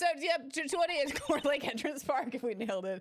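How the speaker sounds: ordinary talk, nothing marked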